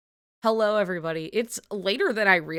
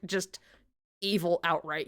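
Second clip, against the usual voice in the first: ordinary talk, nothing marked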